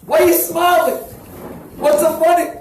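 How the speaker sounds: high, loud angry demand; indignant shout. Retold or imitated. Collector shouting at the smiling rabbi, indignant. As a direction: demand an answer, outraged.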